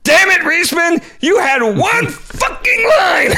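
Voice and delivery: high, loud, slowed-down mock yell at a co-star; exasperated shout. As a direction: blast him for blowing the one line, hammed up. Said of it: "she goes".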